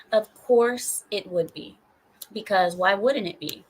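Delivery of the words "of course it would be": ordinary delivery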